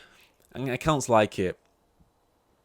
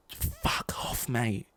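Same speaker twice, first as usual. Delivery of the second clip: fed-up brush-off; 2 semitones higher, 9 dB quieter, much slower than usual. Retold or imitated. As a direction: drag out a tired get-lost. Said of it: "And it's like".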